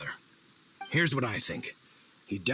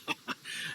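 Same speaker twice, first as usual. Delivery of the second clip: breathy laugh; 7 dB quieter than usual. Acted. Drift laughs at Paimon's remark.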